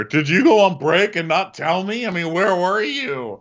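put-on high, loud drunken whine; drunk Emily. Drunk wife accusing the bartender, high drunken whine. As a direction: slur it like a wasted woman at the bar.

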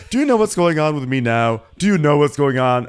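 high, loud hammed-up grievance; Marlo. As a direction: wail the complaint in mock self-pity. Mimics Marlo's aggrieved outburst.

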